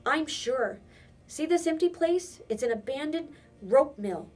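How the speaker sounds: put-on boy alarmed, pleading his case; urgent. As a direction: press the point, alarmed. Peter urgently explains the danger.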